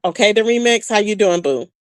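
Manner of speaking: ordinary talk, nothing marked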